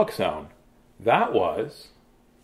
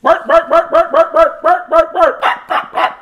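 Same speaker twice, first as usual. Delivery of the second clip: laughing barks; 7 dB louder than usual. Real, not acted. Author barks, laughing after story.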